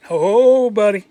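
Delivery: loud, high, drawn-out hail; calling out. Acted. Collector greeting a newbie he has caught, calling out. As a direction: holler it out to a newcomer. Acted call to new collectors.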